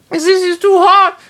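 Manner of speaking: loud, very high shriek; yell. A narrator's reading. Nana burning from the curry, shrieking yell. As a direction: shriek it at the top of the range. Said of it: "Nana yelled".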